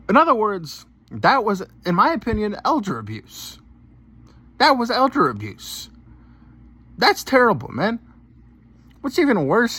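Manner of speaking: outraged. Real, not acted. Outraged rant about debate.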